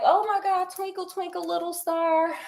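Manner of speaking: lightly voiced startled maid. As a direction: swoon then half-sing it. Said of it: "she up there like".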